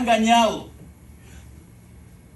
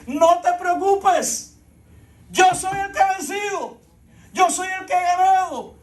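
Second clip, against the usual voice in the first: voice of Jesus; 7 semitones higher than usual. Acted. Jesus voiced reassuring the church.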